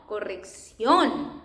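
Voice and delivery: slow corrección, stressed on "ción"; accented final syllable. Shown as a demonstration. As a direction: draw out the last syllable of corrección. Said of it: "an emphasis we do in the pronunciation".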